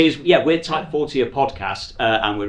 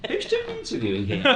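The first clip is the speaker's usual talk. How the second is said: laughing; 10 semitones higher, 3 dB quieter than usual. Real, not acted. Host laughs at own role swap.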